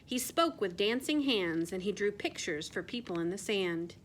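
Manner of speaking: ordinary delivery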